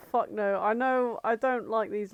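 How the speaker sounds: normal talking voice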